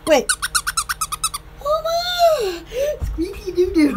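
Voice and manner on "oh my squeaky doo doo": laughing. Real, not acted. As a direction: laugh and squeal at the toy. Laughing at squeaky toy.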